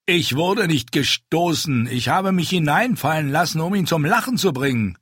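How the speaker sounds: a hint of wounded pride; protesting big man. A narrator's reading. Ente protests to Illyrio he wasn't pushed.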